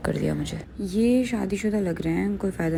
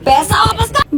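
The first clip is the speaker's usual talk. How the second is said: angry yell; 10 dB louder than usual. Retold or imitated. Family yelling at her at home.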